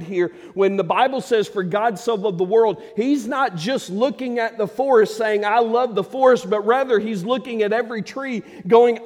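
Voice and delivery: loud, high, driving sermon delivery; a preacher pushing the crowd, loud and high; full-force preaching. Acted. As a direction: preach it hard to the congregation.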